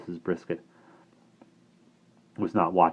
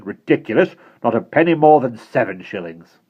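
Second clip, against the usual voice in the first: louder, hard commanding voice; stern headmistress; 4 semitones higher, 8 dB louder than usual. Acted. Missus Brisket ordering Bonnie, stern and suspicious. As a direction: order her about and eye the boy.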